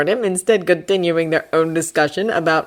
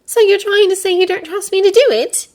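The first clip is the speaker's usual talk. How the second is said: stung; 5 dB louder than usual. Acted. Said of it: "obviously stung".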